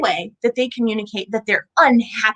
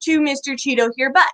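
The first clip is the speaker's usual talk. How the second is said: usual speaking voice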